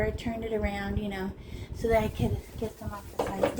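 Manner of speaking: ordinary talk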